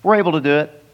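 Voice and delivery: a hammed-up eager yes from the disciples; cocky disciples. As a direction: toss it off as boastful know-it-alls. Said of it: "It's humorous what they said".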